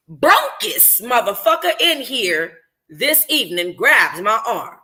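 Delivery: ordinary delivery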